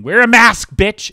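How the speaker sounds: sudden high-pitched, very loud yell; furious shout. Acted. Raging at an unmasked mother, full shout. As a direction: scream it as an insult. Acting out hostile message to mother.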